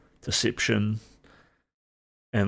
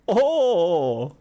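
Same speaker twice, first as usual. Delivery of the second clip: excited surprise; 8 semitones higher, 4 dB louder, much slower than usual. Real, not acted. Hears his name called on stream.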